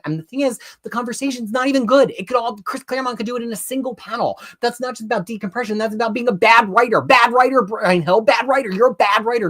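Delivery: high, insistent scolding; angry rant. Real, not acted. Critic scolding the writer for bad writing, angry.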